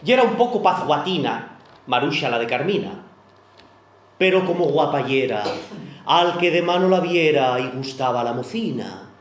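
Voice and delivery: reciting a rhyming monologue to an audience; verse monologue recitation. Acted. Reciting the Carmina story poem for listeners, storytelling. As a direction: tell the verse story with a lilt.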